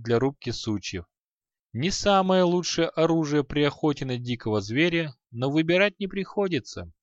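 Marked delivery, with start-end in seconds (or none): none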